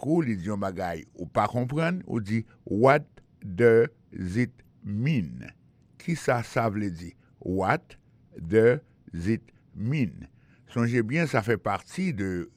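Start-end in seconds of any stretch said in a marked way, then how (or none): 2.6-5.5 extremely slow, one word at a time; slow model question. Shown as a demonstration. Teacher showing what to ask when confused, word by word. 7.4-10.3 very slow, separated words; slow model question. Shown as a demonstration. Teacher repeating the question for learners, word by word.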